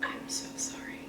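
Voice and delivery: soft unvoiced whisper. Real, not acted. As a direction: breathe the words, no voice. Quiet aside into the camera.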